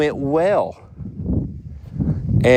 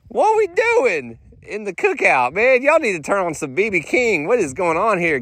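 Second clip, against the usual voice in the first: higher, exaggerated disbelief; hammed-up what-is-going-on; mock outrage; 7 semitones higher than usual. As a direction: play up the indignation.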